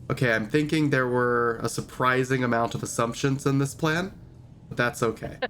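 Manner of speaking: normal talking voice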